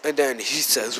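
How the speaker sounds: plain reading voice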